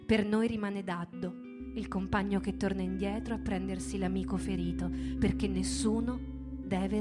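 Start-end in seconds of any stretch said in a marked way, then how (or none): none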